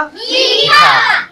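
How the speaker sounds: मीठा held long in a high singsong; long drawn-out high call. Shown as a demonstration. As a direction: stretch मीठा very slow and high, like a sung call. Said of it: "बोलो बेटा".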